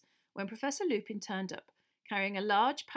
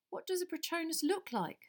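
higher eager question; curious; 9 semitones higher than usual. A narrator's reading. Harry asking Lupin, curious. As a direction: ask with curiosity. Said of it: "said Harry curiously".

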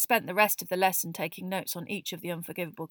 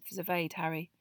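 usual speaking voice

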